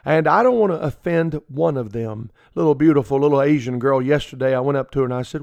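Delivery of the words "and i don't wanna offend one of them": ordinary delivery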